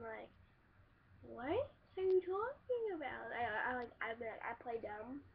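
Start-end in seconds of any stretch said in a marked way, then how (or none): 1.2-3.3 mock confusion. Playing dumb to sister.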